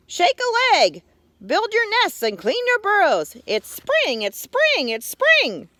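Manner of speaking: high, bouncy cheering; excited little frog. Acted. Tiny frog hollering about spring, high and excited. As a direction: cheer on the whole forest.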